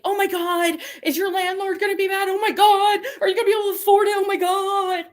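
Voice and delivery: high, loud frantic mock panic with laughter; imitating a panicked friend, laughing through it; mock freaking out. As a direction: squeal it like a friend losing it. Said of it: "she was, like, freaking out".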